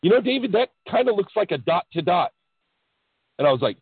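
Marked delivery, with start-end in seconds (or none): 0.0-2.3 a hint of the wife's higher voice; lightly voiced wife. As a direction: gently point out the obvious. Wife's suggestion voiced in story.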